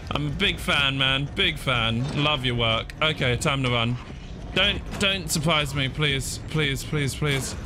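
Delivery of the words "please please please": normal talking voice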